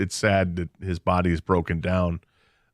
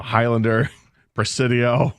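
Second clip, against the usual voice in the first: ordinary talk, nothing marked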